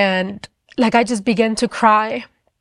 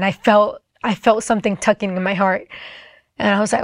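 ordinary talk

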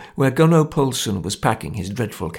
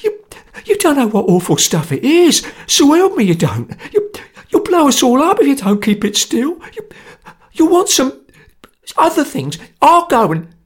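high, stammering, louder terror; panicked pleading; 14 semitones higher, 5 dB louder than usual. A narrator's reading. Sotcher begs the group in high, stammering terror. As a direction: babble to get out, scared stiff. Terrified Sotcher tries to leave.

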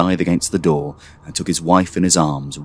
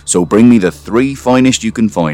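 firm father's orders; 6 semitones higher, 5 dB louder than usual. A narrator's reading.

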